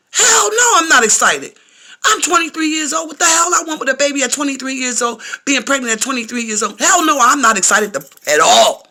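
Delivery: high, loud, outraged refusal; indignant outburst. Retold or imitated. Pregnant patient retelling her answer to the nurse, loudly indignant. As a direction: re-enact the outburst, pitch and volume shooting up.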